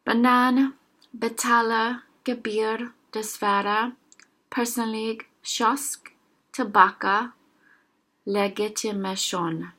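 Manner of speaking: ordinary talk, nothing marked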